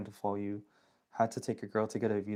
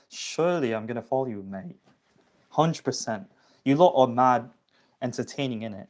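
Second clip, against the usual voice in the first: hammed-up British lad; British accent; 5 dB louder than usual. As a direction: put on a cheeky British YouTuber accent. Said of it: "adaptation of the British accent".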